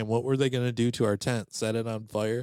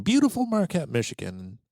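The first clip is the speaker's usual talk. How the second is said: gushing praise; 6 semitones higher than usual. Acted. Gushing about Marquette.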